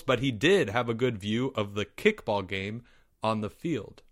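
ordinary delivery, nothing marked

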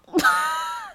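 high shrieking laugh at her own mistake; squealing laugh. Real, not acted. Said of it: "laughing scream".

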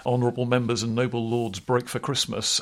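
ordinary talk, nothing marked

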